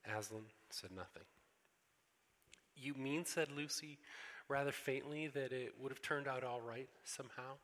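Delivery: storybook reading with voiced characters; story reading. A narrator's reading. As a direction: read it aloud like a bedtime story for the congregation. Pastor reads Narnia story aloud.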